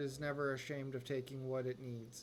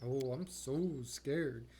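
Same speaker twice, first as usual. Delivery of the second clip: sarcastic; much slower than usual. Acted. Mocking a threatening captive.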